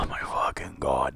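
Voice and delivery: hushed, weary groan of despair; exasperated whisper. Real, not acted. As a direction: breathe it out in defeat. Sighing despair at WWE.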